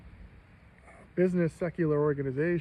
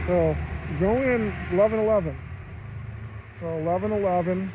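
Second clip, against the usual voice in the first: louder, higher call to viewers; 3 semitones higher, 5 dB louder than usual. Real, not acted. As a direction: shout it out to the chat. Calling out to viewers.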